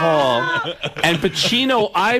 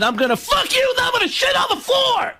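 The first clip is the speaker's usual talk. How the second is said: very high, strained screamed rant; hammed-up shrieking stage yell; screaming comic impression. Imitates dead comic's screaming act.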